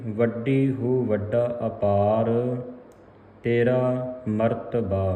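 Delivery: slow, drawn-out recitation; measured demonstration reading of scripture; model scripture reading. Shown as a demonstration. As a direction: read it slowly, phrase by phrase. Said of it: "ਇਸ ਦਾ ਜਿਹੜਾ ਸ਼ੁੱਧ ਉਚਾਰਨ ਹੈ".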